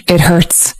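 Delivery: put-on quiet, hurting, like a sad little boy; low pained voice. A narrator's reading. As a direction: say it low through the pain. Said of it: "with a low voice".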